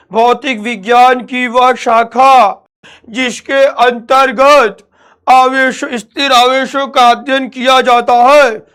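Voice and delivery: hammed-up boring lecture, drawn out and loud; mock rote dictation. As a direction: parody a dull coaching teacher reading the definition word by word. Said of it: "ऐसे नहीं पढ़ना है".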